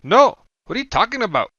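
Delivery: high, loud disbelief; shocked yell. A narrator's reading. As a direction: cry out in shock. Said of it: "The older man replies".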